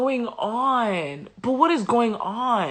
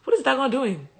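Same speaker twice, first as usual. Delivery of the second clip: higher, startled question; mock bewildered townsfolk; shocked Philistines; 5 semitones higher than usual. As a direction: blurt it out in alarm. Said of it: "So they said".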